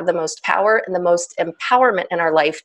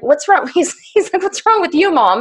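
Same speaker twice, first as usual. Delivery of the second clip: much higher, mock puzzled son; imitating her son; 9 semitones higher than usual. Son asks his snappish mother what's wrong, much higher. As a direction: pitch it way up like the bewildered son.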